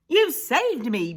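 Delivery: high, loud exclamation; grateful cry. Acted. Big Bark thanking his rescuers, crying out. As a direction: cry out in relief. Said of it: "Big Bark cried".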